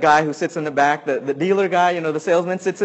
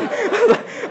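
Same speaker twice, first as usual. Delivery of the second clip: squeaky burst of laughter; high nervous laugh. Real, not acted. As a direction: laugh at yourself. Nervous laugh after story.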